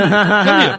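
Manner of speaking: shouted come here, laughing; loud call. Retold or imitated. Retold calling out, first take.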